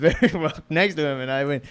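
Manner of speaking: ordinary talk, nothing marked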